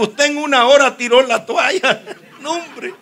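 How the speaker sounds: loud, high, laughing comeback; laughing indignant retort. Retold or imitated. Telling the audience how he scolded the monsignor, loud and laughing.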